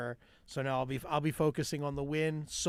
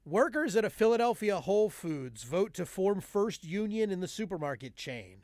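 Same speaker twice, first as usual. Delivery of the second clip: raised, punchy mock newscast; joke news read; 4 semitones higher than usual. Reading a Whole Foods union headline, punchy mock news read. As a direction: deliver the headline, then drive to the punchline.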